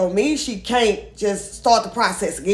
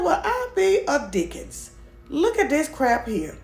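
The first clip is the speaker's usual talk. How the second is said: mock astonishment; 6 semitones higher than usual. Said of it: "Well, I said".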